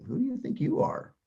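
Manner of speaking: high rising, amazed question; incredulous. Shown as a demonstration. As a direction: ask it in genuine disbelief. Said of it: "Or maybe a tone of voice more like".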